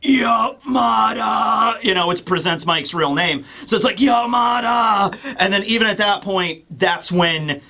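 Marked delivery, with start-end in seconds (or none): 0.0-1.8 drawn-out, strained mock cry; very slow, stretched-out calling of a name; Kurogiri. Kurogiri calling his friend at the last moment, drawn out. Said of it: "actually saying, like". 4.0-5.1 slow, stretched-out mock call; Kurogiri. Kurogiri calling Present Mike's real name, drawn out. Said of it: "actually saying, like".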